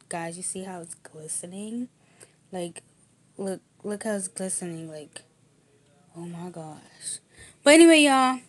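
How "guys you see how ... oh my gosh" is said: hushed. Real, not acted. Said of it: "showing ring quietly".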